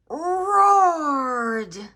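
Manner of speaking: loud, high roaring delivery; big roar for the kids. A narrator's reading. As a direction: let the word roar out like a tiger. Tiger roars in the story.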